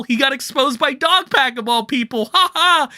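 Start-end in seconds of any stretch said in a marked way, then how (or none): none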